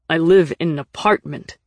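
touchy, forceful comeback; sharp retort. A narrator's reading. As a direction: push back with sudden force. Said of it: "Nick said sharply".

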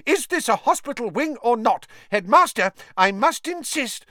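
high, loud frantic protest; hysterical. Acted. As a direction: plead in frantic exasperation. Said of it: "said Madame Pumphrey hysterically".

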